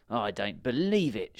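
ordinary delivery

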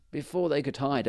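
usual speaking voice

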